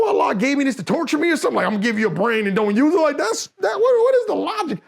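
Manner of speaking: fast, high-pitched indignant retort; exasperated disbelief. Retold or imitated. Arguing against anti-thinking advice.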